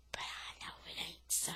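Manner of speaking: soft unvoiced whisper; boy narrator whispering his certainty. A narrator's reading. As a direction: breathe it like a secret. Narrator's private aside.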